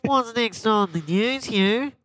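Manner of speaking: high, slow, nasal Australian; mock Australian accent. Handing over to co-host Hugh, put-on Australian accent. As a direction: play an Aussie newsreader with blocked nose. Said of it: "Makes you sound Australian".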